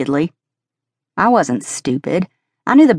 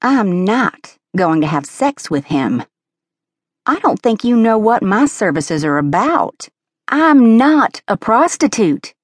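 huffy and offended, building up; uppity; 6 semitones higher than usual. A narrator's reading. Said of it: "came out uppity".

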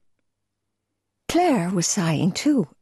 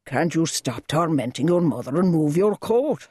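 a hint of a tired father; weary rebuke. A narrator's reading. Father rebukes his daughter, lightly weary. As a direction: ask for peace, worn out.

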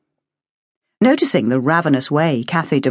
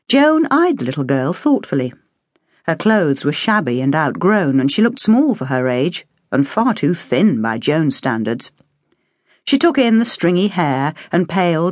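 ordinary delivery, nothing marked

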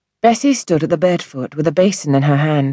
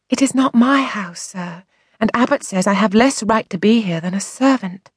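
put-on higher childlike voice, unhappy; upset young girl; 6 semitones higher than usual. A narrator's reading. Orphaned girl telling the doctor her troubles, sad child voice. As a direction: speak as a sad little girl.

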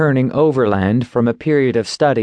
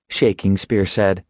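plain reading voice

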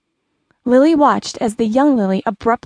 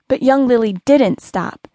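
ordinary delivery

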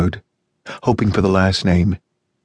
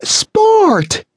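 much higher, louder shrill cry of Sport!; shrill shriek; 5 dB louder than usual. A narrator's reading. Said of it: "Her shrillness".

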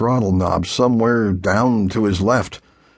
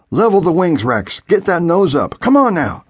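urgent instruction; 7 semitones higher than usual. A narrator's reading.

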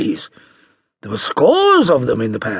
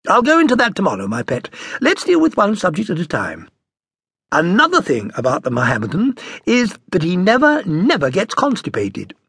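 mother's voice; 6 semitones higher, 3 dB louder than usual. A narrator's reading. Mother lectures the boy.

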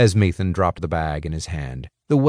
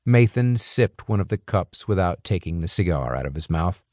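ordinary delivery

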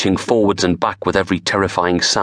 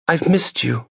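tender confession; 10 semitones higher than usual. Acted. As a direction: say it close, tenderly. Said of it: "She stared up into his eyes".

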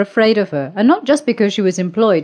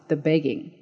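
ordinary delivery

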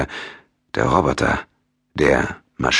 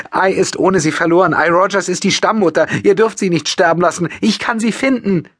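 high, desperate pleading; high tearful young woman begging; 4 dB louder than usual. A narrator's reading. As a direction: beg them in tears. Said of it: "mit flehender Stimme, in die sich nackte Verzweiflung".

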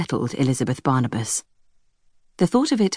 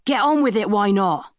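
anonymous shout; 5 semitones higher than usual. Acted. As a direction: heckle it from a distance. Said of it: "The anonymous shout".

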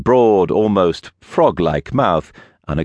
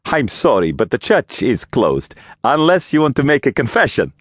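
failed levity; 8 semitones higher than usual. A narrator's reading. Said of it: "failed attempt at levity".

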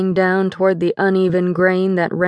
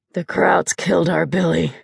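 a lightly voiced drunk father, slurred and gravelly. Drunk father telling his wife about their dead son, slurred and gravelly. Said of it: "in a voice that was slurred and gravelly".

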